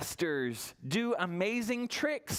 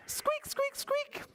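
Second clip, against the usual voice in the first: tiny high squeak squeak; mouse squeaks; slower than usual. Shown as a demonstration. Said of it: "can you squeak like a mouse".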